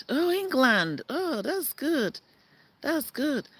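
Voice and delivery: light up at the good news; delighted. Real, not acted. Greeting a viewer who says they are from England, delighted.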